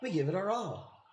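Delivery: lightly mimicking her cheesy inflection; a hint of a chirpy promo voice; cheesy imitation. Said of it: "her inflections are a little cheesy".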